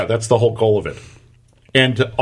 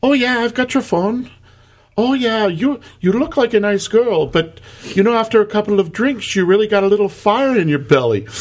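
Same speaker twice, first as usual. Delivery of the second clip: high, sweetly scolding Irish voice; mock-motherly Irish lecture; 10 semitones higher than usual. Bartender scolds the girl over the phone in an Irish accent. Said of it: "She goes".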